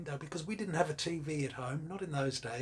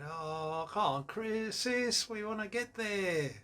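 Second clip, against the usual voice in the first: high-pitched, drawn-out mock whine; pleading little sibling; 6 semitones higher, slower than usual. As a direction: coax big sister like an impatient kid. Retold child pleading with big sister.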